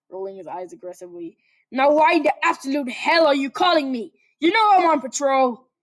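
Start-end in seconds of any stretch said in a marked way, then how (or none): 1.7-5.7 loud, raised irritated yell; angry. Acted. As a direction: demand answers, annoyed. Katsuki yelling at Deku.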